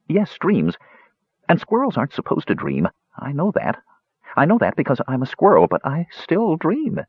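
lightly voiced anxious squirrel; nervous squirrel voice. Squirrel explains dreams.

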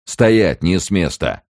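rough voice. A narrator's reading. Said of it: "грубый голос произнёс".

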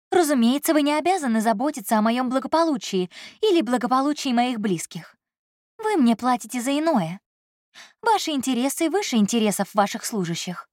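a proud secretary holding her ground; Elsa's voice. Acted.

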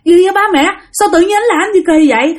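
high, fast startled question. Retold or imitated. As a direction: blurt it out in shock. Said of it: "bạn hết hồn".

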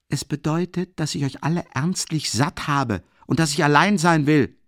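ordinary delivery, nothing marked

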